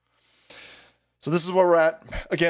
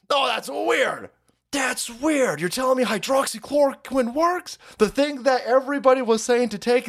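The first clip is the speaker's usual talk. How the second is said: sarcastic surprise; 7 semitones higher than usual. Acted. Sarcastic mock surprise at news.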